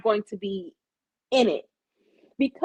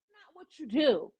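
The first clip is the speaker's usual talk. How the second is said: usual speaking voice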